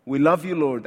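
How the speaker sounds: ordinary talk, nothing marked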